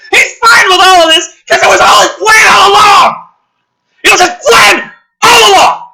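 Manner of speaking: shouting, building outrage; angry rant. Real, not acted. Ranting to the audience about the plot, shouted. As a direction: yell at the absurd plan.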